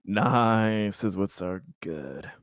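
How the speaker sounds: usual speaking voice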